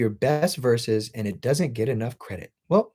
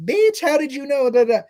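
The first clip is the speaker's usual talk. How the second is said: "Bitch, how did you know" in a much higher, squealed put-on voice; mock girly squeal; 12 semitones higher, 4 dB louder than usual. Joking with a female fan, much higher squealing put-on voice. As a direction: playfully shriek it in a high joking voice at a fan. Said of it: "and I'm like".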